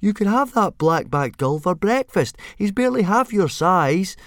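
high, loud coaxing; exasperated urging. A narrator's reading. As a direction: goad a reluctant pet into attacking. Urging dragon to hunt gull.

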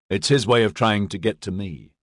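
usual speaking voice

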